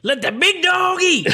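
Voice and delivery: high, loud and slow Brickma line. Shown as a demonstration. Friend's cue answered in a slow, high Brickma voice. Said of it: "you say it like Brickma".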